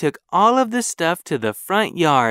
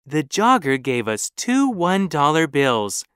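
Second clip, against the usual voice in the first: ordinary delivery, nothing marked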